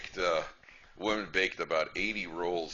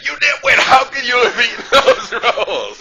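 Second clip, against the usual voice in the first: much higher, loud hammed-up wail of outrage, slow; mock outburst; 20 dB louder, slower than usual. Wife sitting up in bed at 2am yelling at husband, hammed-up high outburst. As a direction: explode at him half laughing.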